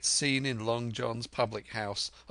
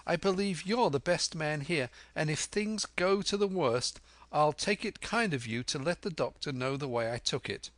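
put-on higher, urgent young voice; spirited lad making his appeal; Jim, earnest and bold; 7 semitones higher, 3 dB louder than usual. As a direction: address the pirate boldly, pitched up.

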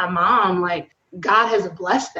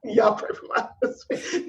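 mock whispered aside; 5 dB quieter than usual. Real, not acted. Joking aside about cussing husband.

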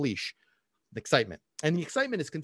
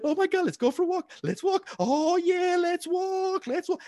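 squealing, very high-pitched hype; over-excited dog owner. Acted. Owner whipping the dog up for a walk, over-excited. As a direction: spiral up into a giddy falsetto squeal.

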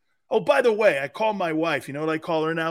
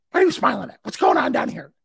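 imitating his wife; 7 semitones higher, 6 dB louder, faster than usual. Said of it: "My wife comes downstairs".

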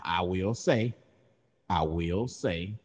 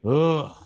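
loud, high groan of getting up; effortful grunt; 8 semitones higher, 11 dB louder than usual. Shown as a demonstration. Host imitates noise of getting up, loud effortful grunt. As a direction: heave out a big imitated groan. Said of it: "you get up, you make the".

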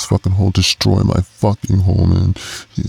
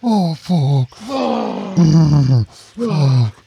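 much higher, strained moans of pleasure; aroused moaning; 12 semitones higher, much slower than usual. Real, not acted. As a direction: let the voice climb and break with real arousal. Climax during sex, moaning.

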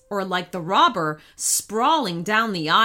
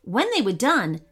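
ordinary delivery

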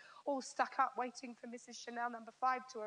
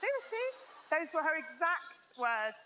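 high, loud and slowed, still stunned; amazed emphasis; 4 semitones higher, 6 dB louder than usual. Real, not acted. Preacher to the women, stressing the quote was real: loud amazed emphasis.

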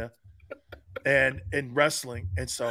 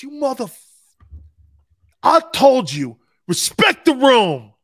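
angry yell; 9 semitones higher, 11 dB louder than usual. Retold or imitated. Veteran yelling at rookies who stepped on the emblem, furious. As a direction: blow up at the rookies. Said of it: "And Hardy goes".

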